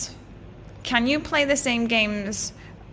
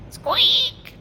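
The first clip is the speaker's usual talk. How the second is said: tiny squeak, rising; 13 semitones higher, 5 dB louder than usual. Acted. Dragon squeaks again.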